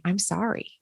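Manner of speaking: soft, quiet and sincere; face-to-face apology. Shown as a demonstration. Apologizing to someone face to face, softly. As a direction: say it softly, looking into their eyes. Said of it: "looking them in the eyes and saying".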